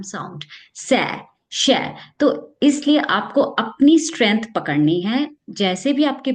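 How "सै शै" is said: slow सै, sharper शै; showing the student's method; student's s-sh drill. Retold or imitated. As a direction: sound out सै then शै. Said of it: "उसने करके दिखाया".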